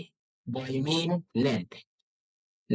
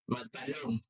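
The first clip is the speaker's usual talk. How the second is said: ordinary talk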